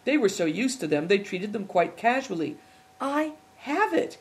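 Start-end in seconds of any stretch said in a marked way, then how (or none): 3.0-4.2 high and slow, a flash of discovery; light up with the idea; sudden excited cry. A narrator's reading. Big Tiger suddenly solves the puzzle, excited. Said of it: "he cried suddenly".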